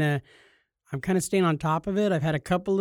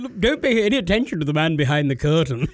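mock misdirection voice; 2 semitones higher, 3 dB louder than usual. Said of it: "You're like".

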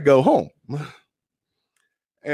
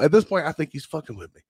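ordinary delivery

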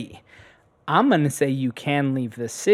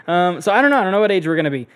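normal talking voice

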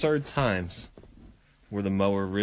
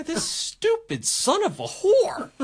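mock rant; slower than usual. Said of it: "I'm like".

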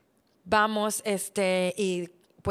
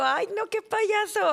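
playful scolding; 12 semitones higher, 4 dB louder, slower than usual. Retold or imitated. Teasing husband about song joke.

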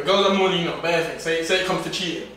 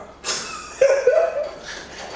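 long high laugh that won't stop; high squealing laugh. Real, not acted. Friends cracking up at a joke.

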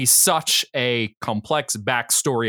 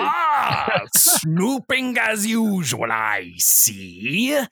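high shouted cartoon villain; Robotnik voice; 7 semitones higher, much slower than usual. In character as Robotnik.

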